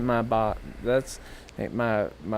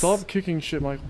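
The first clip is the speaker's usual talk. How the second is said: mock scolding dad; 5 semitones higher than usual. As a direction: play an exasperated dad telling off a son. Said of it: "my dad will be like".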